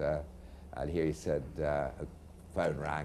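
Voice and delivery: ordinary talk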